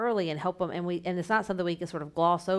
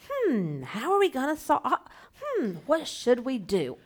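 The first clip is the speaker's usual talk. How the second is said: thinking hmm; 5 semitones higher, slower than usual. Pretend. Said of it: "you can do a whole big thing and like".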